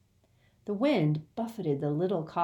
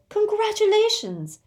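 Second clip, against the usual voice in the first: much higher, loud squeal; 8 dB louder than usual. Acted. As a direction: squeal with delight. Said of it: "Hermione and Fleur both squealed".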